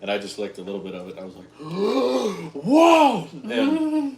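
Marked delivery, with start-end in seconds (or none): 1.5-2.6 sudden high gasp of shock; sharp gasp. Retold or imitated. Reacting to super sour liquid. 2.6-4.2 high loud startled whoa; shocked. Retold or imitated. Reacting to super sour liquid.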